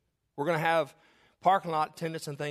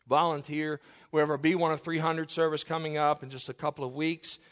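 ordinary talk

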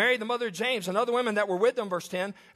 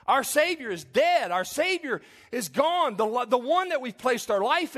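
grieving disciples; 6 semitones higher, 3 dB louder, slower than usual. Acted. Voicing disciples' despair.

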